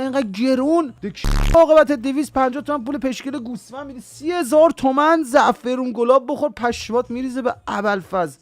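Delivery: a hint of disgusted mockery; mocking disgust. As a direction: sneer at the sheep-dung tea. Mocking disgust at matcha drinkers.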